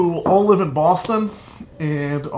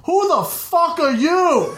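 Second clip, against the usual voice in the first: high, slow mock bellow of a startled uncle; angry uncle impression; 11 semitones higher, slower than usual. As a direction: play the uncle who finds a stranger at home. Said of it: "I hear my Uncle Pete going".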